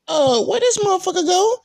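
high-pitched bewildered question; mock puzzlement. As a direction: wonder aloud in a high, puzzled voice. Puzzled reaction to missing homie.

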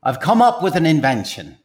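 salesman pitch in a put-on Irish accent; stage Irishman with a secret to share; Irish accent, pitching his invention to the host.

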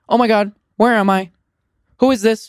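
put-on high, loud frightened little girl; a lost child crying out in panic; high shrill child voice, scared; Charlie's voice. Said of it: "Charlie's voice".

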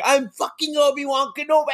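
high, hammed-up Scottish outburst; Ewan McGregor. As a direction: burst out indignantly in a thick mock-Scottish accent. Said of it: "his Scottish accent came through".

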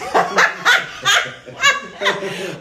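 high squealing laugh bursting out; giggling at her own prank; burst of laughter. Real, not acted. Host squeals with laughter as the prank begins. Springs water-gun prank on guest.

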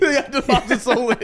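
high, loud laugh while saying it; helpless laughter over the punchline; laughing hard. Real, not acted. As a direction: break up mid-phrase. Repeats joke, cracking up.

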